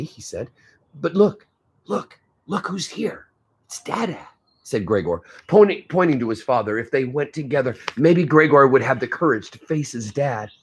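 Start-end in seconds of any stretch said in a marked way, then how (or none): 1.0-4.3 coaxing. Acted. Gregor coaxing his little sister.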